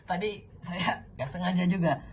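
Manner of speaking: ordinary talk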